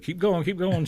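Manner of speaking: high-pitched imitated encouragement; lightly voiced eager prompting; urging on.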